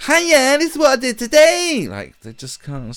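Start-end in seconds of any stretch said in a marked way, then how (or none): 0.1-1.9 much higher, louder mock vlogger greeting; sing-song mock YouTuber intro. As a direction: ham up a chirpy kid vlogger. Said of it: "and I was going".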